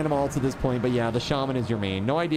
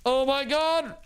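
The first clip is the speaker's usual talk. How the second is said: amazed exclaiming; 12 semitones higher than usual. Real, not acted. Floating into the sky.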